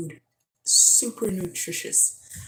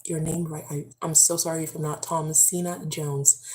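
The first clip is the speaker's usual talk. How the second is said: normal talking voice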